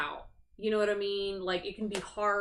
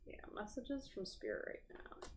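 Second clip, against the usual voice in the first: soft whisper calling on spirit; 15 dB quieter than usual. Real, not acted. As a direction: whisper it softly. Tarot reader calling on spirit.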